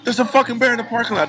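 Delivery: fast, high alarmed report; retelling a panicked warning to the locals. Retold or imitated. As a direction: blurt out the news of the bear. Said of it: "Everyone's like".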